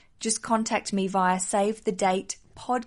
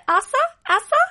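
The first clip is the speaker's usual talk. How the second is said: higher, louder attempt at a Swedish accent; badly faked Swedish pronunciation, pitched up; terrible Swedish accent; 12 semitones higher, 7 dB louder, faster than usual. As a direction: try the name in a bad Swedish accent. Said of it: "that's a terrible accent".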